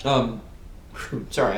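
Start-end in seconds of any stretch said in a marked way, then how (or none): 0.0-0.4 mid-yawn. Real, not acted. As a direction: yawn through the word. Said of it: "I yawned".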